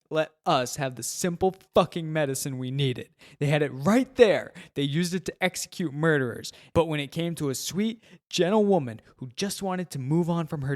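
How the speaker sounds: loud, anguished crying rant; drunken sobbing. Acted. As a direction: sob it out, raw and furious. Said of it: "he sobs".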